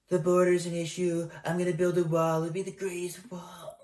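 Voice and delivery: strained mock Trump voice; impersonating Trump straining on the toilet; constipated Trump. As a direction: push the words out like you're straining. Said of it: "sounds like he's constipated".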